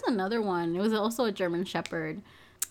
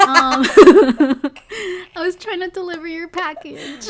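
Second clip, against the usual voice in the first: laughing; 10 semitones higher, 12 dB louder than usual. Retold or imitated. Continues service-voice apology with laughs.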